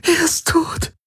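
high, sobbing wail. Acted. Ylana sobs to Garlan that he is dead. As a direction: let the grief crack through, high. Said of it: "schluchzte Ylana".

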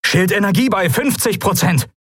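high-pitched called-out report; urgent status report. A narrator's reading. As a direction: call the reading across the noisy bridge. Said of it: "meldete Quailor, nur wenig erleichtert".

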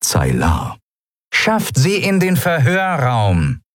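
slow, high crackly command; loudspeaker order. Acted. Captain to the guards over the speaker, crackly order. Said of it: "rauschte eine vertraute Stimme aus einem Lautsprecher".

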